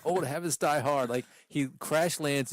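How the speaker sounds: ordinary talk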